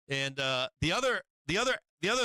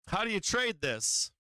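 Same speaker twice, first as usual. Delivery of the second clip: normal talking voice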